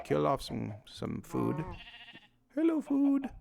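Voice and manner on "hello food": high-pitched sing-out to an animal; playful high greeting. Pretend. Player greeting chickens they hunt for food, playful high voice. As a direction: coo it way up high.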